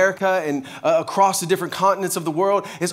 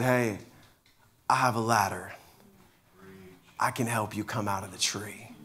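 lower, softer, kind helper; gentle neighbour offer; 10 semitones lower, 10 dB quieter than usual. Retold or imitated. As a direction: offer help softly.

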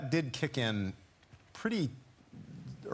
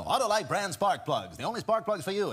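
high-pitched fifties commercial announcer; old radio ad man; 8 semitones higher, 3 dB louder than usual. Retold or imitated. Actor imitating old radio commercials, high-pitched announcer. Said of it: "everyone had this high-pitched voice".

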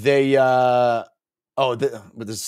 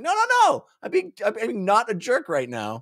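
pitched way up, pleading; high insistent voice; 10 semitones higher than usual. Retold or imitated. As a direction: squeak it out earnestly.

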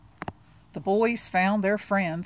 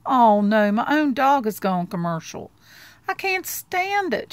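louder, rising to a much higher wail; dismayed outcry; 4 semitones higher, 6 dB louder than usual. Acted. Charlie Brown over Snoopy's contest flyer, louder and then a high wail. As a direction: cry out in exasperated despair.

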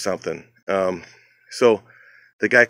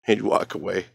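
normal talking voice

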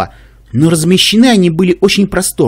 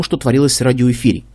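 usual speaking voice

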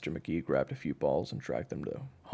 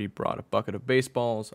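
plain reading voice

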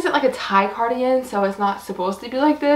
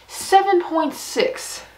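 slow, drawn-out, higher, like a suspenseful reveal; dramatic rating reveal; 7 semitones higher, much slower than usual. Real, not acted. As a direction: stretch every number out to build suspense.

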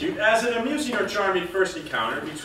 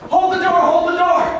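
loud, high urgent call; shouting to hold the elevator; 10 semitones higher, 8 dB louder than usual. Acted. Calling to someone at the elevator, loud urgent shout. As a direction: yell across the lobby for the door.

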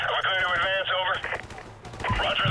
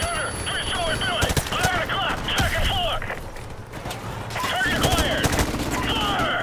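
shouted fire orders; 10 semitones higher than usual. Acted. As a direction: call the target over gunfire. Gunner fire orders in combat.

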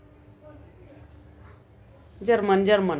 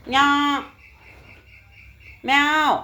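higher, louder mock meow; 4 semitones higher, 3 dB louder, slower than usual. Owner meowing back at her cat in a mock meow.

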